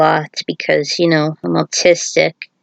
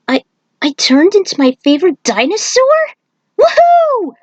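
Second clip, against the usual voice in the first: thrilled whoop; 11 semitones higher than usual. Acted. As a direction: voice jumps high into a cheer. Aiko thrilled at dinosaur form.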